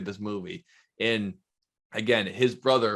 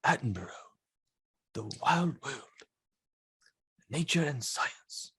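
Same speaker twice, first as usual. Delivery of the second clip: nature documentary narrator; 4 semitones higher, 6 dB quieter than usual. Retold or imitated. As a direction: draw it out like a wildlife documentary. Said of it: "Attenborough ring any bells".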